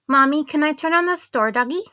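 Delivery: put-on high, loud eager child voice; excited little girl. Daughter asking mommy in the store, excited child voice. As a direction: bubble over like a thrilled kid. Young daughter speaking in story.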